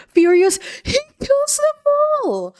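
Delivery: high-pitched shocked squeal; aghast, voice leaping high; shocked outburst. Real, not acted. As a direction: start loud, shoot up into a squeal. Reacts to a sudden mass killing.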